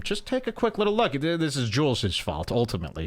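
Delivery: ordinary talk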